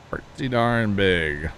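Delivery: "Pretty darn big" drawn out slowly, each word stretched; slow emphatic repeat. Real, not acted. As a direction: savour it slowly, "darn" leaned on.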